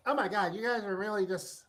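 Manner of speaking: mocking voice talked through the nose.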